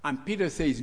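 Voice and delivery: usual speaking voice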